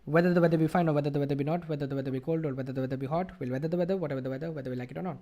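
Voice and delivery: fast, rattled off in one breath; rapid-fire tongue twister; speed reading. Shown as a demonstration. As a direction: race through the tongue twister. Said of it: "a version where I can just focus on speed".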